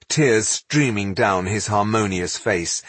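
plain reading voice

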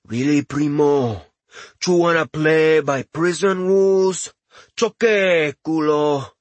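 put-on thick Latin accent, swaggering. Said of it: "In a thick Latin accent".

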